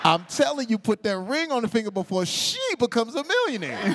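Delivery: high, excited comic plea; urgent urging. Retold or imitated. Host urging the boyfriend to propose, excited pleading. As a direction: press him hard, pitch jumping up.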